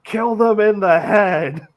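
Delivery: high, loud movie battle cry; hammed-up shout. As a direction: shout it like a shotgun-toting bar lady. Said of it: "cocks a shotgun and shouts".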